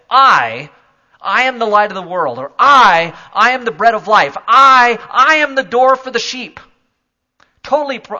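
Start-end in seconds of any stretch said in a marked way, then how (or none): 0.1-6.6 each "I" punched loud before "I am"; emphatic doubled I. Shown as a demonstration. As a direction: stamp every repeated "I" hard. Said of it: "quite accurate to say".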